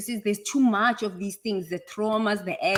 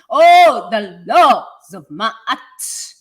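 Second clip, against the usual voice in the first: impassioned cry; 11 dB louder, much slower than usual. Acted. Speaker invoking the laws of Ma'at to herself, impassioned cry. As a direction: cry it out as an invocation. Impassioned invocation while preaching.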